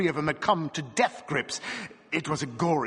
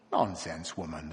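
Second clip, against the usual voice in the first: mild angry dismissal; 10 semitones lower, 6 dB quieter than usual. A narrator's reading. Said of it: "the bareback rider said angrily".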